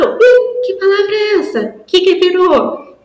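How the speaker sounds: hammed-up teacher wonder; mock surprise. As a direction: act astonished to draw the child in. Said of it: "Deixa a criança responder".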